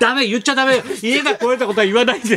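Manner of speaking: high, urgent hush-up in a woman's role; 増田よし子. Retold or imitated. Co-host voicing a colleague forbidding any mention, high and urgent. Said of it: "増田よし子がな".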